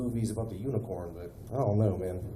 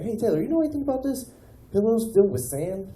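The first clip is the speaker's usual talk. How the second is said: baffled call to Taylor; 8 semitones higher, 3 dB louder than usual. Retold or imitated. Calling Taylor about sand pillow.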